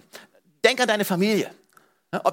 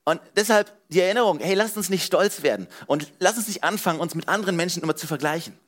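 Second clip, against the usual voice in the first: ordinary talk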